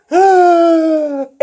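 squeaky dramatic gasp; hammed-up high gasp. Toy figure gasping at the villain reveal, hammed-up. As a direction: gasp as if at a twist.

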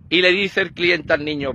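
normal talking voice

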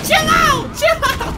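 very high, loud plea; panicked shout. Real, not acted. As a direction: yell it high to call off an attacker. Panicked under attack.

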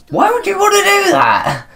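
very high squeal held on one pitch; squeaky acted protest. Player voicing a game character, squeaky protest. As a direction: squeal it in mock horror.

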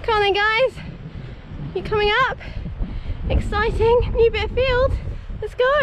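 rally them up the field; bright sing-song call to the herd; excited calling. Real, not acted. Calling ponies to new grass.

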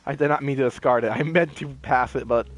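usual speaking voice

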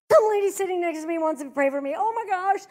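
fast, loud, hammed-up wailing into the phone; mock crying outburst. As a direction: imitate a distraught wife complaining to her husband. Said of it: "She's just crying".

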